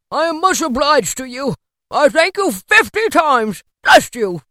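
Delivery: high, loud gushing thanks; effusive Scrooge, grateful. A narrator's reading.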